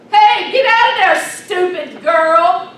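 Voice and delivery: loud, angry yell from far off. Retold or imitated. Stranger catching a little girl at the barn, a loud angry yell. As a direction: bark it like someone catching a kid. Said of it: "somebody yelled".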